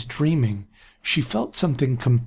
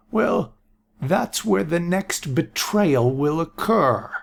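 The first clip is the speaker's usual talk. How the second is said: put-on higher, slow ominous old man; old seer voice; 6 semitones higher, slower than usual. A narrator's reading. Aaron warning the council, slow old voice. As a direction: foretell it gravely.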